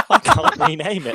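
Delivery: high, loud panicked cry. Retold or imitated. Coworker retelling his rename panic, high loud panic. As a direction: blurt it out in a retold panic. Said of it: "I started panicking and crying at my desk".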